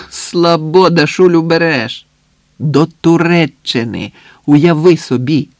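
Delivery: loud, drawn-out proud reveal; emphatic, teasing brag to a friend; boastful excitement. A narrator's reading. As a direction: savour the big news slowly and loudly. Friend boasts of trip destination.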